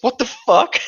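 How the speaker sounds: playful mock shock on waking; mock shocked wake-up. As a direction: jolt awake in disbelief. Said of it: "They just wake up in their bed".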